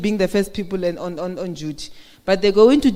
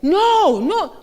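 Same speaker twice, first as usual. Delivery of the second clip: a sharp, much higher "no, no", the first one louder; mock shouted no; 9 semitones higher, 4 dB louder than usual. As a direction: cut them off with a high, loud no. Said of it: "you're being shouted at".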